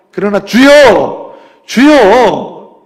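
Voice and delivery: slow, loud cry of Lord; pleading cry. Acted. Pastor voicing the woman crying Lord, loud and slow. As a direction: stretch the Lord into a desperate cry.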